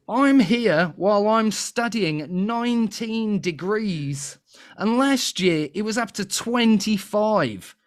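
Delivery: high, slow, put-on Australian accent; terrible Australian accent. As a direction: drag it out in a badly faked Australian accent. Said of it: "the accent is terrible".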